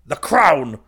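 very loud, high angry shout. A narrator's reading. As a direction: explode with the answer. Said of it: "I said angrily".

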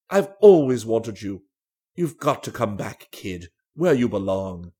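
low, slow, insistent appeal; earnest old flame urging her back; pleading old suitor. A narrator's reading. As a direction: plead in a low, measured man's voice. Danby pleads with Julie to return.

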